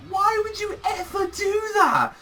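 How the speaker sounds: very high-pitched disbelief; incredulous. Real, not acted. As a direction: squeal the question in exasperated bafflement. Baffled at the characters' decision.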